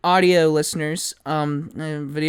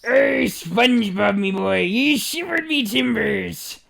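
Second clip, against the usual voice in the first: high, slow cartoon crab-captain voice; Mister Krabs; 5 semitones higher, slower than usual. Repeating the Mister Krabs impression for his cohost. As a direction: swagger it like a cartoon sea captain. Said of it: "Mister Krabs".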